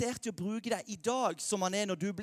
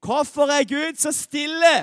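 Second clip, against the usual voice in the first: «Hvorfor er Gud så stille?» called out louder in unison, with a big push on «stille»; said loudly together; 4 semitones higher, 8 dB louder than usual. Shown as a demonstration. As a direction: lead the room in saying it out loud. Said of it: "Skal vi, skal vi si det sammen?".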